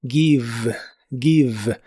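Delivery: "Give" ending in a buzzing, lip-on-teeth V; "give" with a vibrating V. Shown as a demonstration. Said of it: "labiodental y vibrante".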